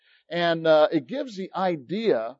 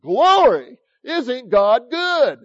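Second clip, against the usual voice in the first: louder, higher cry of praise; joyful shout; 5 semitones higher, 7 dB louder, much slower than usual. Retold or imitated. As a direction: burst out praising God. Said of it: "I was excited with him".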